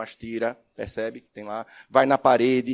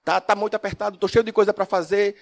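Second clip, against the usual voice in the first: pleading students; 6 semitones higher, 4 dB louder than usual. Retold or imitated.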